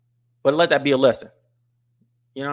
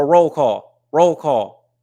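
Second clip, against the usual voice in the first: shouted call-out to the chat, louder; loud roll call; 2 semitones higher, 8 dB louder, much slower than usual. Real, not acted. Streamer hyping his live chat into a loud roll call. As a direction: call the roll like a hype man.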